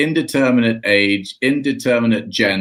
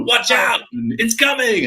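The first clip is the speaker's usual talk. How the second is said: higher, mock alarmed video-game yell; hammed-up warning cry; game screams; 11 semitones higher than usual. Brother voicing game screams, higher mock yell. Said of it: "all those screams".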